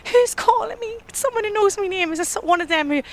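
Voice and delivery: put-on scared girl calling into the night; anxious. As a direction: peer into the dark, frightened. Squinting, scared, at unseen caller.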